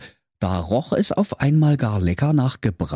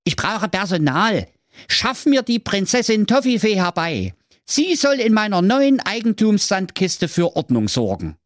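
high, imperious hero; cocky Schwerthelm; 11 semitones higher than usual. A narrator's reading. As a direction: issue orders like a spoiled master, high-pitched. Cocky hero orders the spirit. (übermütiger Befehl in hoher Stimme)

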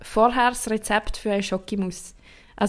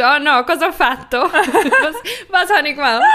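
lachend, lauter Oma-Jammer; italienische Oma; 5 semitones higher, 5 dB louder than usual. Retold or imitated. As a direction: halb italienisch, laut verzweifelt. Enkelin spielt Nonna nach. (Enkelin spielt die verzweifelte italienische Oma, lachend)

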